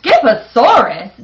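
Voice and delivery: declaring. Acted. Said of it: "declared Mama Junebug Jones".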